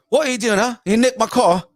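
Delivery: high, loud Cockney accusation; Cockney accent. Shown as a demonstration. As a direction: challenge them in punchy Cockney. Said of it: "that's, that's Cockney".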